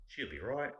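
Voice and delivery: put-on quick "She'll be right" in an Aussie accent, pitched high and rising; Australian phrase practice. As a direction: try out the Australian line brisk and high. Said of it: "say it in different ways".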